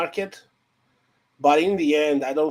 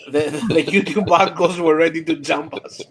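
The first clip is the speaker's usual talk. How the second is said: laughing; 4 semitones higher, 3 dB louder than usual. Real, not acted. Laughing while telling story.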